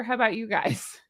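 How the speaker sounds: ordinary delivery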